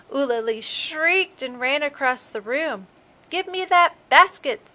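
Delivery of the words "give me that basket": high, loud furious demand; angry shout. A narrator's reading. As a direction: snarl it, the shark wants food. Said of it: "in his most angry voice".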